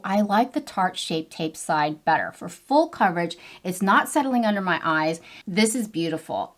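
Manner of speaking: ordinary talk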